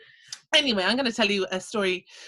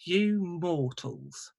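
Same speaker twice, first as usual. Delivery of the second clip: very slow, lower scornful sigh of a line; weary disdain; 3 semitones lower, 3 dB quieter, much slower than usual. Acted. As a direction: drawl it down at the silly human. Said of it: "said the little man".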